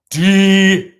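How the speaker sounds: high, drawn-out yell of the word; shouted cheer. Real, not acted. Fan watching the game yells for defense, shouted cheer. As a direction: holler it loud and high like a fan.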